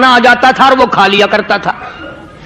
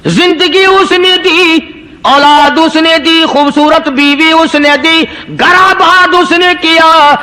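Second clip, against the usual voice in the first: slow, higher, emphatic preaching; preaching cadence; 4 semitones higher, slower than usual. Acted. Preacher lists God's blessings to the crowd, slow and emphatic. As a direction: hammer each line home slowly. Listing God's gifts rhythmically.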